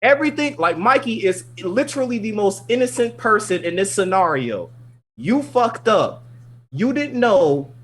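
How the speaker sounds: high, emphatic rant in disbelief; indignant scolding. Real, not acted.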